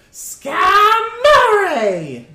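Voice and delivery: high, loud, stretched screech; metal bird cry. Acted. Big metal bird bursting out, piercing name-cry.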